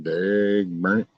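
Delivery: "Dag burn it" dragged out slow, "burn" rising; a lazy drawn-out folksy oath; slow drawl. Acted. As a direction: stretch every word of "Dag burn it" out slowly. Mock cursing, said twice.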